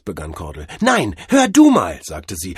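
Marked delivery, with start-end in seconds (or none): 0.8-2.0 loud, high, furious interruption; angry retort. Acted. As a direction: cut him off with raised voice. Mavis cuts Cordell off in anger. (wütend und laut dazwischenfahren)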